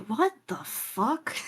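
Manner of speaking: slow, soft aside, lightly acted; muted disbelief. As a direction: draw it out quietly, a touch incredulous. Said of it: "kinda going".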